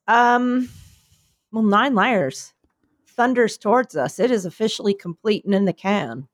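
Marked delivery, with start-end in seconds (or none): none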